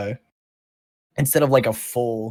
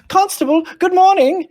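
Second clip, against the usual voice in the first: gnome calling out; 8 dB louder than usual. Acted.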